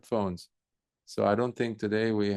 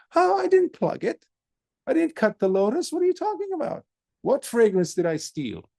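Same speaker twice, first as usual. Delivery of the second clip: very high, loud, baffled protest; can't believe he's accused over a smell; high squeaky monk asking what he stole; bewildered monk; 14 semitones higher, 5 dB louder than usual. Acted.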